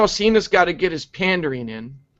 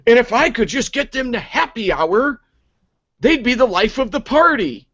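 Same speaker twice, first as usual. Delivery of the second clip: mock Cena voice; 7 semitones higher than usual. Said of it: "he says".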